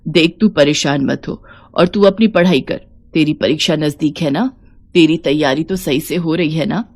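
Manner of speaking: put-on low-pitched elderly woman, reassuring; affectionate elder urging her on; kindly old mother-in-law. Old Savitri reassures daughter-in-law.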